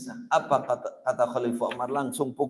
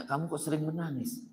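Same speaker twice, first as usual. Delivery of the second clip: soft question; 11 dB quieter than usual. Retold or imitated. Preacher asks crying friend softly.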